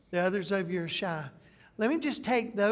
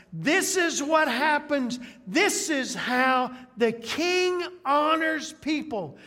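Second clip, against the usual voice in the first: royal proclamation; 7 semitones higher, 5 dB louder, slower than usual. Acted. Haman imagines royal proclamation.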